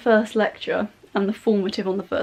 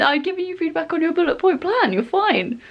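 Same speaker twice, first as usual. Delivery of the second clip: mock brush-off, pitched up; higher-voiced, mildly mocking retelling; dismissive tutor; 7 semitones higher than usual. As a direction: imitate a tutor waving off a worry. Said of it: "She said it with a tone that implied".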